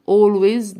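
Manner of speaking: 'always' ending in a buzzed Z; loud, drawn-out 'always' said 'ol-wayz'; S sounded as Z. Shown as a demonstration. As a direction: model 'always' slowly with a Z at the end. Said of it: "هنا ال S ننطقها Z".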